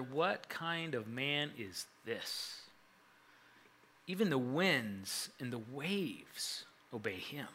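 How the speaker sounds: huddled voices, hushed and amazed; hushed awe. Acted. Disciples asking each other after the storm, hushed and slow.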